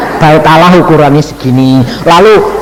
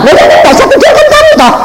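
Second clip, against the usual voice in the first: very high, squeaky voice of a cheeky caller; hammed-up high caller voice; 3 dB louder than usual. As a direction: mimic the smug caller, way up high. Caller answers the preacher back.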